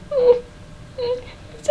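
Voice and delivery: tiny high whimper; very soft, squeaky pretend sob; mock whimper. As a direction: whimper pitifully, barely voiced. Whimpering over sad episode.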